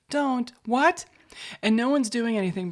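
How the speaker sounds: ordinary talk, nothing marked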